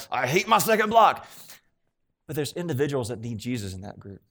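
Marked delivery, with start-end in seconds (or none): 0.1-1.3 higher-pitched acted grumble of a fed-up teacher; mock exasperation. Voicing a teacher looking at second block, mock exasperation. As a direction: complain in playful, hammed-up frustration.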